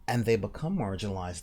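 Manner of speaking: normal talking voice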